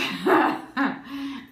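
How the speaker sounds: short nervous laugh; a real nervous laugh. Real, not acted. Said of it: "It's kind of a nervous laughter sometimes".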